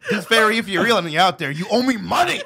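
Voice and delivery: loud and high, laughing through it; calling out, laughing. Pretend. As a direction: shout up to the sky half-laughing. Calls out to the tooth fairy.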